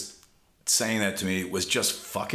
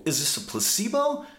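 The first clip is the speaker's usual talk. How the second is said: alarmed question; 8 semitones higher than usual. Retold or imitated. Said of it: "I was like".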